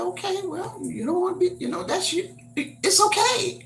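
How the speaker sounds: high voice reassuring the grandkids it's okay; reassuring grandma. Retold or imitated. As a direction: soothe them, it's okay. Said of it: "I said".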